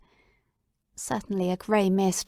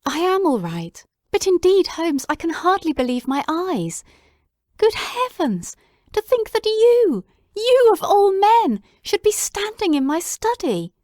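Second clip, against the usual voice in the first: overjoyed disbelief; 10 semitones higher, 5 dB louder than usual. Acted. Watson marveling that Holmes stands in his study, high and loud. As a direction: marvel at him, overwhelmed.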